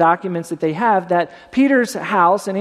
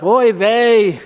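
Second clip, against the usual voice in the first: a light Yiddish "Oy vey", groaned; Yiddish accent; 4 dB louder than usual. Retold or imitated. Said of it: "she's like".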